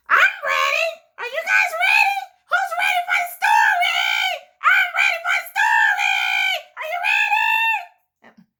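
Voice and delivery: very high, shouting puppet squeal; Libby bursting with excitement, yelling; loud excited puppet. Acted. Libby the puppet yelling she is ready for the story, high shout. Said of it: "you just are so loud, Libby".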